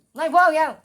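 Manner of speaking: sudden high, very loud exclamation; loud outburst. Real, not acted. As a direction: blurt it out far too loud. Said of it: "I'm sorry, was that loud?".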